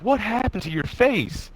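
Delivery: high, drawn-out mock alarm; imitated shocked parent. Parent walking in on son's injured face, imitated shock.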